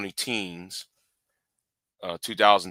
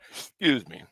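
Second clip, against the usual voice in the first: yawning; 3 semitones higher than usual. Real, not acted. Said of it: "I'm yawning as well".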